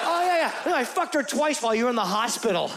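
breezy, over-the-top sarcastic yeah, pitched high. Shown as a demonstration. Caught cheater lies to his girlfriend by telling the truth sarcastically. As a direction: toss off the truth as if it were a joke. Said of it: "sarcastic inflection in your voice".

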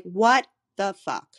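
slow, loud, drawn-out incredulous curse. Retold or imitated. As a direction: punch it out slowly like you can't believe it.

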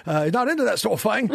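Cockney with "thing" as "fing"; Cockney accent. Retold or imitated. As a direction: show them your Cockney. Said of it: "I got a Cockney accent".

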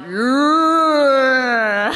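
drawn-out slow-motion cheer; slow motion yell. Acted. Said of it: "Bit of slow motion".

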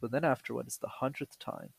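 ordinary delivery

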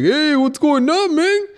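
squeaky high mock greeting; listener's greeting. Listener relieved the sound is back, squeaky high greeting. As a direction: pop up high and friendly, hey man. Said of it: "You're like".

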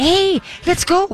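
high, slow, bright coaxing call to the dog; sing-song encouragement to a reluctant dog; cheerleader voice. Shown as a demonstration. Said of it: "Become the cheerleader".

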